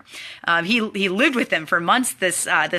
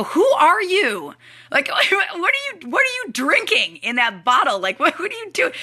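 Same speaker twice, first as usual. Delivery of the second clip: amazed disbelief; 7 semitones higher than usual. Retold or imitated. To the fast treadmill runner, retold as amazed disbelief. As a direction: act stunned by the runner. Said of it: "I look at him, I go".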